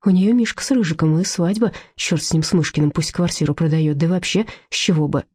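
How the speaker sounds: usual speaking voice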